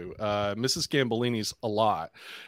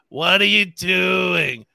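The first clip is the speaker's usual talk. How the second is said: put-on high, loud, slow old-lady croak; Roz from Monsters Inc; 7 semitones higher, 8 dB louder, slower than usual. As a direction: drag it out like a crabby old clerk. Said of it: "I can only do Roz from Monsters, Inc".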